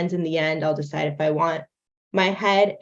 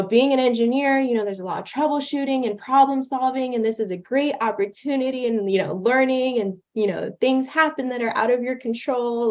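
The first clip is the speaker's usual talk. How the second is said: put-on higher-pitched explaining-to-children voice; kid lesson; 5 semitones higher than usual. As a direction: turn the glitch into a cheery lesson for kids. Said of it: "make some kid lesson of".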